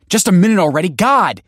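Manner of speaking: high, loud irritated yell. Acted. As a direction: yell through the door. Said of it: "she yelled".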